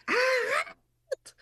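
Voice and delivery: sudden very high piercing yell; shout. Retold or imitated. Mother yelling at child fleeing toward the boulevard, sharp shout. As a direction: stop a child running to the road with one cry. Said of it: "puis que je crie".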